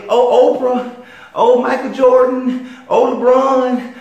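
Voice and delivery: high, loud, slow swooning plea; mocking whine. People running to celebrities for help, high mocking whine. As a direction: whine each celebrity's name as a mock plea. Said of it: "So don't sit whining".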